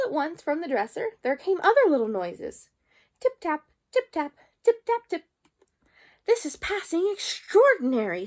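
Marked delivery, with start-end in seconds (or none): 3.2-5.3 high, louder tip-tap; tapping noises. A narrator's reading. Noises from the dresser. 6.3-8.3 higher, louder astonished remark; amazed tailor. A narrator's reading. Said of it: "said the tailor of Gloucester".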